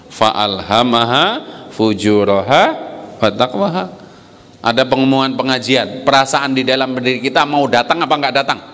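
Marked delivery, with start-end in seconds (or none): none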